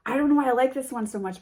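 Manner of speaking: fast, bubbly rave about a card; excited gush. Real, not acted. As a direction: burst out in happy disbelief. Gushing over a favourite card.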